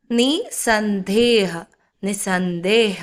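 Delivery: നിസന്ദേഹ stretched slowly, no "ha" after "ni"; silent visarga. Shown as a demonstration. Teacher blending a word for learners, നിസന്ദേഹ slow with silent visarga. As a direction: sound out നിസന്ദേഹ as "ni-san-deh". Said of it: "ഇവിടെയും അത് silent ആണ്".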